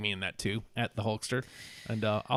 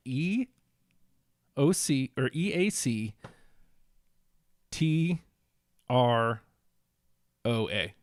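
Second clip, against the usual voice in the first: slow spelling; slower than usual. Real, not acted. Slowly spelling a hashtag.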